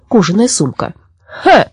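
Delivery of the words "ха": short, high exclamation; mild shout. Acted. Forester spotting another old woman, mild shout. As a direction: call it out abruptly, high-pitched. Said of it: "крикнул лесничий".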